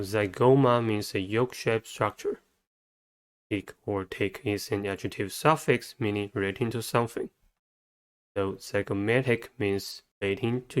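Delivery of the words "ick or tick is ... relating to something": usual speaking voice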